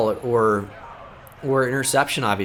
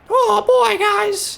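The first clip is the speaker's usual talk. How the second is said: much higher squeaky cartoon voice; Mickey Mouse voice; much slower than usual. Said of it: "He's Mickey Mouse".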